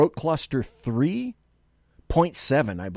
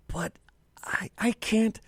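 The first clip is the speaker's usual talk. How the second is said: dropped to a hushed whisper; 9 semitones higher, 4 dB quieter than usual. Real, not acted. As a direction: whisper it like a confession. Aside before playing the clip.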